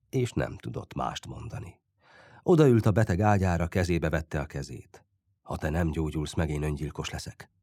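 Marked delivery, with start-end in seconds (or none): 5.4-7.5 low, soft, sinking away; understated grief, voice dropped low; hushed and moved. A narrator's reading. Friend holding the sick boy's hand, hushed.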